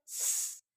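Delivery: a voiceless स hiss; s sound alone. Shown as a demonstration. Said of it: "एस का sound क्या होता है?". (बिना आवाज़ के स)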